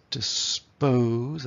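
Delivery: dis-POOOSE, second syllable stretched; short "dis", long heavy "pose" in "dispose"; heavy second beat. Shown as a demonstration. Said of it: "That's the heavy beat".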